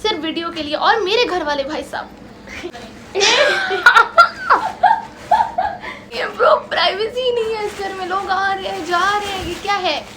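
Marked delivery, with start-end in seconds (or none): 2.1-6.1 real high laughter that takes over. Real, not acted. Family barges into room. 6.2-10.1 real laughter running through a mock complaint; laughing complaint. Real, not acted. Family barges into room.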